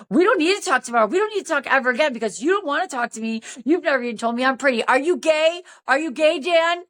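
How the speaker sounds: rapid, high-pitched retold tirade; going off. Tipsy woman berating Dan by phone, flirty indignation. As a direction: pile up complaints without a breath. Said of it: "I went off on him".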